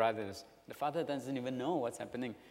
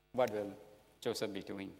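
ordinary talk, nothing marked

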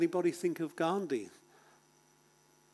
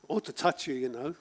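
usual speaking voice

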